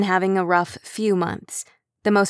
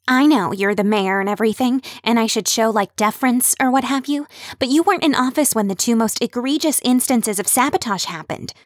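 higher, red-faced insistent voice; flustered Kim; 4 semitones higher than usual. Acted. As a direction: push through the nerves.

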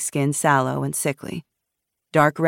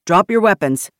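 louder, higher armed command; shouted order; 4 semitones higher, 7 dB louder than usual. A narrator's reading. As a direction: order them sharply to disarm. Armed guard ordering strangers.

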